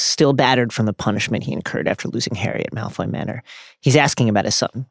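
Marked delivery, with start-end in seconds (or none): none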